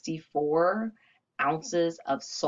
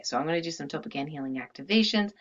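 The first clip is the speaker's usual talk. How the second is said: usual speaking voice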